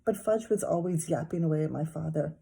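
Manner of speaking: usual speaking voice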